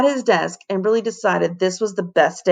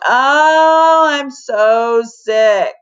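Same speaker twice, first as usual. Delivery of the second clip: slow, pitiful put-on groan, higher; fake sick groan; 6 semitones higher, 6 dB louder, much slower than usual. Boy fakes sickness for his father, groaning slowly. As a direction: ham up being ill to skip school. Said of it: "he pretended to wheeze as he groaned".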